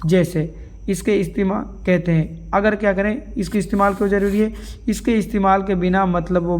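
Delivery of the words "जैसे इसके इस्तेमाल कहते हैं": ordinary delivery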